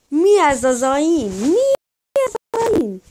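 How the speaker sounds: loud, high incredulous shout; outraged disbelief. Real, not acted. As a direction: yell in disbelief. Outraged at opponent's aim.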